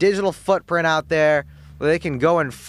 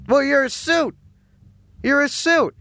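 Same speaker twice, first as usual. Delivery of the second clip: high, mocking jab; mocking jeer; 7 semitones higher than usual. Host heckling the CEO on air, high mocking jeer.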